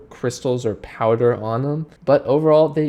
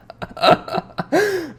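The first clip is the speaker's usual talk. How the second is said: high burst of laughter; real laugh. Real, not acted. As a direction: laugh out in amused disbelief. Laughs at blue tongue.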